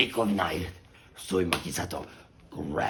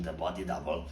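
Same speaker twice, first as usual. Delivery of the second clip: ordinary delivery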